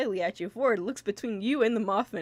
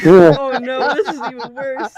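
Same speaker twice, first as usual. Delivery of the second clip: loud laughing groan; 14 dB louder than usual. Real, not acted. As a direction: laugh out the words. Laughing hard.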